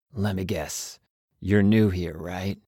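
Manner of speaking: knowing, laid-back drawl; cool traveler's voice. Acted. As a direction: size up the newbie, unhurried. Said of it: "I do need to change voices".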